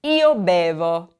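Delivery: io bevo, slow and clear; EE-oh BEH-voh, slowly; model for repeating. Shown as a demonstration.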